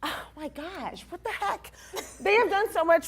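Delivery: high, laughing and emotional. Real, not acted.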